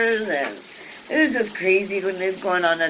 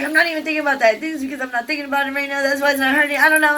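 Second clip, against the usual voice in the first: higher, rushed rambling; mock nervous rambling; babbling; 7 semitones higher, 3 dB louder, much faster than usual. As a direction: talk fast to distract yourself. Retold rambling excuse.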